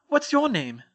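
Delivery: a hint of brisk schoolgirl; young witch. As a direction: Hermione inviting the elf, lightly voiced girl. Hermione asks the elf.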